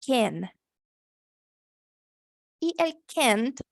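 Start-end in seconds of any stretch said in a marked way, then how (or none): 0.0-0.5 "Can" with an E-like vowel; American can. Shown as a demonstration. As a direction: say "Can" short, with an E sound. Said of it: "con E".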